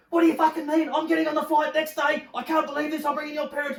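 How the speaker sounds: very high, loud mock screech of an angry girlfriend; furious girlfriend. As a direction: shriek it like a girlfriend losing it on the phone. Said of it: "she was like".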